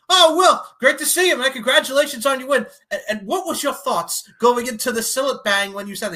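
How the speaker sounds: high, loud mock reporter voice; imitating a reporter. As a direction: ham up a chirpy UK interviewer. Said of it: "the U K guy's like".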